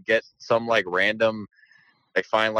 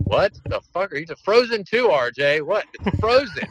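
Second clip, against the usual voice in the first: mock disbelief; 8 semitones higher than usual. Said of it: "they're like".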